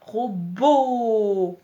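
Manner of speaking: "Robot" loud, with a long drawn-out last "o"; mot étiré. Shown as a demonstration. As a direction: stretch the end of "Robot" loudly. Teacher sounds out whole word. (« Robot » fort, le dernier « o » très allongé)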